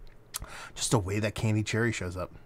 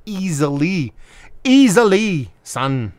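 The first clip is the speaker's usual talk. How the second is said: put-on half-hearted Jamaican accent, louder and higher on the repeat; attempted Jamaican accent; 4 semitones higher, 8 dB louder, much slower than usual. Beer reviewer giving his verdict in an attempted Jamaican accent. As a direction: have a playful stab at a Jamaican accent. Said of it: "I tried to do a Jamaican accent".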